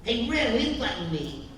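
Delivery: high, loud cartoon sidekick; bright goofy cartoon cat voice; Stimpy voice. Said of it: "it's like, you know".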